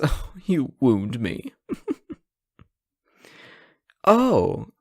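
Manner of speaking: ordinary delivery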